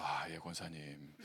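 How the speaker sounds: soft, slow, meek yes; meek pastor. Retold or imitated. Mocking how the gentle pastor would answer, soft and slow. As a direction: mimic a timid pastor giving in. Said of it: "혼자서".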